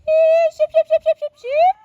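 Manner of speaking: very high, loud chanted holler; sheep call. Real, not acted. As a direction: call the sheep in with rapid repeated shouts. Calling the flock in.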